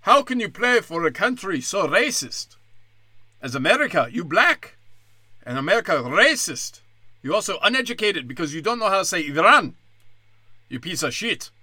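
accusing reporter in a hammed-up Iranian accent; broken English, mock Iranian accent. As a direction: interrogate them in a caricatured Iranian accent. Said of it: "an Iranian reporter before the game".

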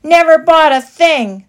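high, loud jeer. A narrator's reading. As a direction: taunt the mice. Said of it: "they jeered".

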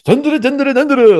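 high, booming newsreader parody; North Korean news anchor. Retold or imitated. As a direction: bellow it like a state TV anchor. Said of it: "the North Korean news anchor impression".

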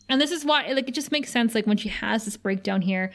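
usual speaking voice

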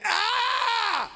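high reenacted yell of pain; pained scream. Scream of pain at his own injury. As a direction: scream out as the elbows hit the floor. Elbows burst, screaming for help.

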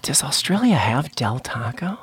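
whispered aside, slowed down; a slow whisper, thinking aloud. Real, not acted. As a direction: mutter the question under your breath to yourself. Aside while guessing the answer.

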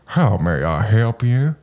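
put-on slow Southern-accented droid; a light Southern drawl as a polite protocol droid. Host imitating the droid's Southern accent, slow and polite. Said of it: "with a super Southern accent".

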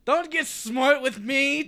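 slow, loud menacing warning; threatening. Acted. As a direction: lean in and threaten him. Thug warns player.

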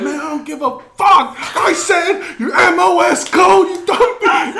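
high, loud chewing-out, hammed up; mock enraged yell, stretching the words out; furious sergeant imitation. Drill sergeant going off on a kid, mock fury. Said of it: "going off on it".

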